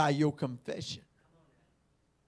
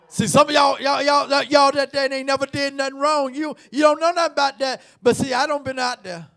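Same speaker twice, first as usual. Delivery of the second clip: louder, higher preacher's call; preacher addressing the sinless ones; full-force preaching; 8 semitones higher, 10 dB louder than usual. Acted. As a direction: press the point with heat.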